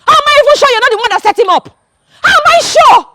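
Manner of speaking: shouting her husband's innocence; angry shout. Acted. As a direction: hurl it back at him in fury. Wife shouts at accusing visitor.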